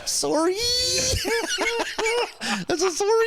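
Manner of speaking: high-pitched words squeezed out through laughter; laughing. Real, not acted. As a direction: talk while cracking up. Laughing hard mid-story.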